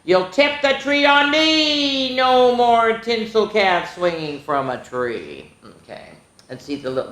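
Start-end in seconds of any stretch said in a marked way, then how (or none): 0.0-2.2 much higher, slow, hammed-up whine; mock daddy complaint. A narrator's reading. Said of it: "Daddy yells". 2.2-5.5 put-on lively read-aloud for young kids; sing-song picture book voice; storybook reading.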